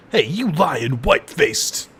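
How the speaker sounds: slow, gruff Barrett impression with a put-on accent. Streamer voicing Barrett at a character, slow put-on Barrett accent. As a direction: growl it out like Barrett from the game. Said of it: "my Barrett accent".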